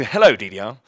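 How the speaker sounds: ordinary delivery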